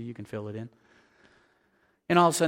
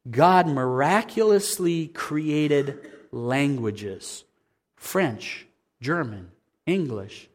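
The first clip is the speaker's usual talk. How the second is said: very slow, weighty declaration; preacher slowing down to proclaim; slow solemn emphasis; 2 semitones lower, much slower than usual. Acted. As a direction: lay each word down slowly.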